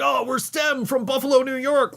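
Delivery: hammed-up band introducing itself; mock stage intro. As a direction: announce it like a bright stage greeting. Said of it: "they'd just be like".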